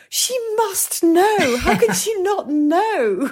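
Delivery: high, slow, amazed reader voice; drawn-out amazed whisper to herself; dramatic inner voice. Retold or imitated. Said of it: "I'm like".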